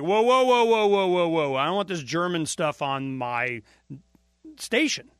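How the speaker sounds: loud, high mock protest; alarmed objection. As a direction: imitate the owner cutting it off in a panic. Owner objecting to German promo.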